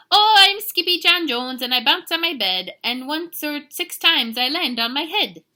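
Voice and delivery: high, loud bouncy chant; excitable kitten hero. Acted. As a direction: belt out the rhyme as a giddy storybook kitten.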